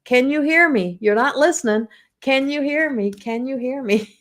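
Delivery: mock little voice trying to be heard; calling for attention. The divine trying to get our attention, playful high calling. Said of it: "They're going".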